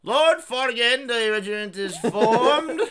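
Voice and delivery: high, loud, slow proclamation; mock military herald. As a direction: announce it to your lord like a pompous soldier. Plays an officer reporting to Lord Fartigan.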